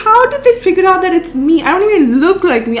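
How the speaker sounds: high, loud amazed question; stunned squeaky disbelief; shocked disbelief. Retold or imitated. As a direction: gasp it out in shock. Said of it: "I'm like".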